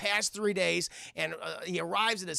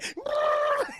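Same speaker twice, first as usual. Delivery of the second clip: crazed laugh; 4 dB louder than usual. Retold or imitated. Mel laughing madly.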